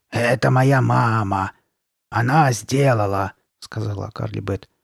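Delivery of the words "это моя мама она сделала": slow, stretched monster rasp; raspy mask voice. Acted. Masked girl explaining the head, slow rasp. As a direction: rasp it out, vowels drawn long. Carly Beth in her mask voice.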